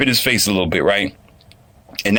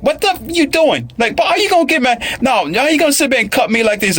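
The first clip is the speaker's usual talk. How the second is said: very high-pitched indignant squawk, imitated; hammed-up irate complaining; mock irate woman. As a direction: imitate an outraged woman in falsetto. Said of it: "she gets irate. She's like".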